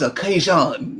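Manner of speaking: Cajun said the French way, long last vowel; French accent. Shown as a demonstration. Correcting how Cajun is said, French accent. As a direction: say Cajun with a French "zhon" ending. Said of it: "say it in your French accent".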